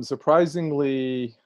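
ordinary talk, nothing marked

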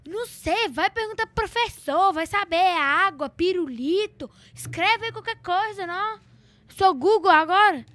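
high, emphatic mock exasperation; clownish exasperated mom. Mother answering a nagging child about homework, comic exasperation. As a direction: act out a fed-up mother, punching each item. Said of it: "minha mãe também é muito palhaça".

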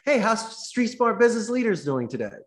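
high, loud, bright cold-call opener; upbeat phone greeting. Shown as a demonstration. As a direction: greet the receptionist with lively cheer.

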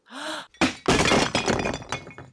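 sudden breathless gasp of shock; sharp gasp. Acted. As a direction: gasp at what she sees. Sees a stranger in mirror.

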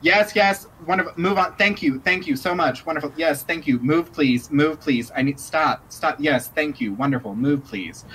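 high, quick dismissive thank-yous; brisk hostess brushing people off. Acted. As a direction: shoo the crowd along politely but impatiently. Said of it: "be like".

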